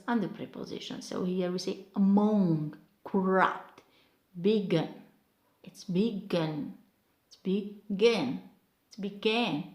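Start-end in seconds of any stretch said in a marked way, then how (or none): 2.0-4.9 a-MONG, cor-RUPT, be-GIN; second-syllable stress. Shown as a demonstration. Teacher repeating the examples, second syllable stressed. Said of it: "stress pattern on the second syllable". 5.6-9.6 be-GIN, drawn out on GIN; second-syllable stress. Shown as a demonstration. Teacher correcting the word, second syllable stressed. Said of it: "stress pattern on the second syllable".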